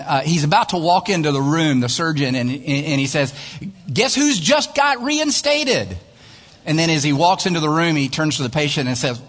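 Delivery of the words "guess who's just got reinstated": high, drawn-out showy announcement; gleeful announcement. Acted. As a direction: announce your comeback, high and stretched. Surgeon's boast in retold ad.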